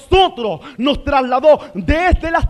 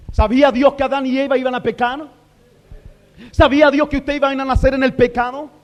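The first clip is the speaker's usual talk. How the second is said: ordinary talk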